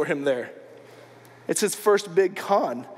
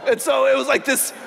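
laughing; 5 semitones higher, 3 dB louder than usual. Real, not acted. Laughing at own retold story.

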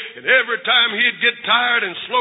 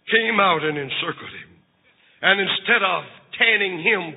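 low, hushed, tender narration; tender storytelling; 5 semitones lower, 4 dB quieter than usual. A narrator's reading. Preacher telling of mother embracing son, tender. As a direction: lower the voice and tell it gently.

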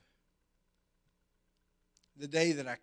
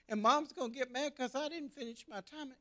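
put-on tearful boy; 11 semitones higher than usual. Crying boy to dad.

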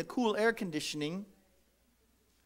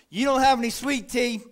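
higher, louder indignant complaint; mock rude customer, louder; 3 semitones higher, 6 dB louder than usual. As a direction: demand the missing tea. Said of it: "like this one lady came up".

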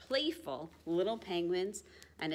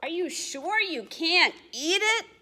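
high, louder hopeful squeak; hungry penguin; 9 semitones higher, 7 dB louder than usual. Acted. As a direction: ask hoping it's food. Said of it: "asks a hungry penguin".

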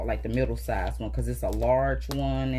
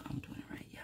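whispering; 17 dB quieter, much faster than usual. Real, not acted. Muttering to herself.

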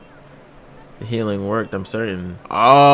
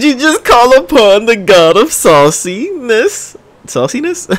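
much higher rising squeak of disbelief; 9 semitones higher than usual. Real, not acted. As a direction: repeat the word as if you can't believe it. Disbelief at the summon.

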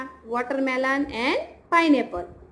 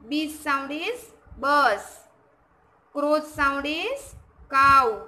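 slow, deliberate, word by word for the class; slow teaching demo; slower than usual. Shown as a demonstration. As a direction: spell each animal sound out slowly. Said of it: "So आपको ये learn भी करने हैं". (धीमी, साफ़ पढ़ाने वाली आवाज़)

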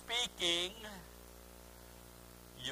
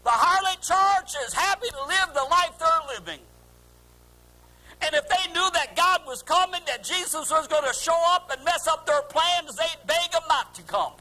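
high, sustained pulpit cry; fervent sermon, voice raised high; impassioned preaching; 9 semitones higher, 6 dB louder than usual. Acted. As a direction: preach it out at full force. Preaches at full force.

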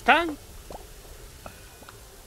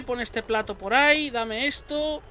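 normal talking voice

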